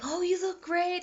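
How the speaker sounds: very high, gushing put-on voice; imitated delighted crowd. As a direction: gush over someone's costume. Voicing everyone's delighted reaction.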